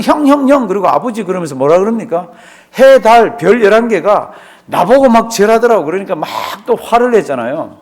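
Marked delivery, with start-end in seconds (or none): 0.0-0.7 higher, eager calling of hyung; pestering little brother. Retold or imitated. Young Joseph calling his older brothers, eager and high. 2.8-5.8 louder, higher excited bragging; boastful dream telling. Retold or imitated. Joseph bragging to his family about his dream, excited.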